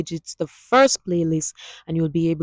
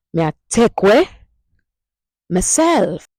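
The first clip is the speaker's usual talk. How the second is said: louder, higher Jamaican Patois line; higher Patois demo; 4 semitones higher, 6 dB louder than usual. Shown as a demonstration. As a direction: repeat it with more energy for the class. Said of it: "Hear that?".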